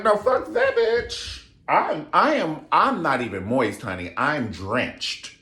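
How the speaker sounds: playing the lusty bride, camp and bold; mock seductive high voice; sassy retold Duchess. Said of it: "And she was like".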